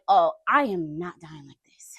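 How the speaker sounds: low, slow whisper of resolve; whispered thought. Retold or imitated. Thinking while being strangled, determined whisper. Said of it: "I was like".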